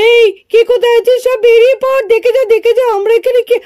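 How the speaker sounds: excited calling out. Acted. Boy shouting to hidden friends about the gold, excited call. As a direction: call everyone out of hiding, thrilled. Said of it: "আস্তে কথা বল চিন্টু".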